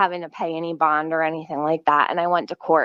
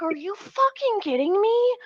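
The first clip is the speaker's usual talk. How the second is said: much higher, incredulous retold cry; retold outrage; 13 semitones higher than usual. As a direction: replay your disbelief, pitched way up. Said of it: "I'm like".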